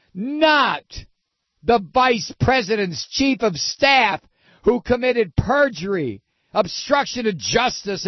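high, whiny hammed-up indignation; high-pitched, insinuating mock protest; mock deflecting pundit. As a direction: parody an outraged apologist changing the subject. Parody of deflecting pundits continues.

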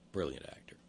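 hushed aside; breathy whispered remark. Real, not acted.

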